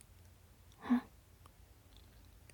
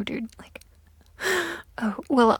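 ordinary talk, nothing marked

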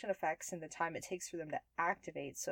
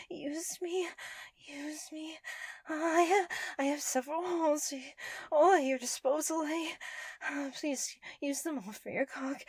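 put-on breathless pleading anime girl; 8 semitones higher, 5 dB louder than usual. Transformed girl pleading, continuing role.

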